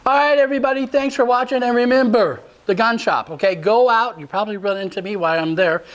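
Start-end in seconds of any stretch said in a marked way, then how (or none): 0.0-4.2 announcer sign-off. Acted. Host signs off show.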